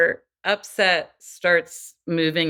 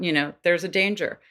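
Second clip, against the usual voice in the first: ordinary delivery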